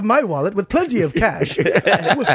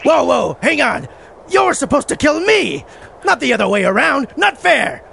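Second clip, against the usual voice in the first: indignant; 6 semitones higher than usual. Acted. Indignant at the wrong death.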